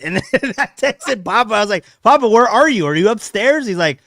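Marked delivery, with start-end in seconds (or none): 2.0-3.7 mock calling out. Retold text to Papa.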